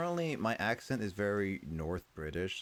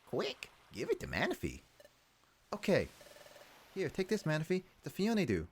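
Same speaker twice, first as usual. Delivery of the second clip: higher-pitched, urgent character voice; game character; 11 semitones higher than usual. Acted. Character hurrying to hand Manaphy something, high voice.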